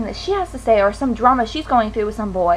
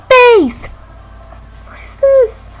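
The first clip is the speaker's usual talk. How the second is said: loud, high, stretched-out exclamation; dismayed outburst; 8 semitones higher, 12 dB louder, much slower than usual. Real, not acted. Showing viewers the stress bumps on her face, loud dismay. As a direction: cry out in exaggerated horror, pitched way up.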